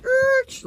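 high screeching brake noise. Retold or imitated. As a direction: screech like skidding tires. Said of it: "hit the brakes here".

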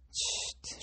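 a soft unvoiced shh to hush her; tender whispered shush. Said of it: "súgta vissza".

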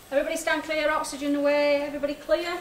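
loud, firm safety call. Real, not acted. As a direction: call the team clear before the shock. Clearing team before defibrillator shock.

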